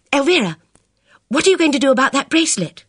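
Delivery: high, anxious rush of words; fretful girl's voice; agitated. Acted. Bridget voiced.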